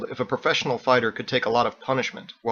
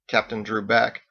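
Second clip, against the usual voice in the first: ordinary delivery, nothing marked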